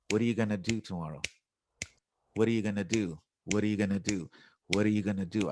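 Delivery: repeated rhythmic drill of "What are you gonna do"; connected "What are you gonna do", evenly counted; counted cadence. Shown as a demonstration. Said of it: "listen to what I'm saying, what counted".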